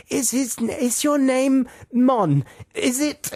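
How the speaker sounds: high, drawn-out tentative questions; hammed-up hesitant guesser; guessing the name. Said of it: "they come back with lots of different names".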